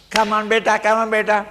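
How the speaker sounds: loud high coaxing call; coaxing father. Retold or imitated. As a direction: call the child, teasing. Mocking father coaxing child.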